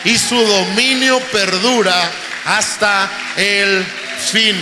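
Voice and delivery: loud, slow, proclaiming; preaching at full force. Acted. Preacher to the congregation, at full force. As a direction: thunder the words to the church.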